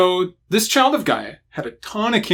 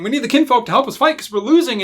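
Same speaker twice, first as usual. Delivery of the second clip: urgent Garou plea; 7 semitones higher, faster than usual. Retold or imitated. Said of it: "sitting here going".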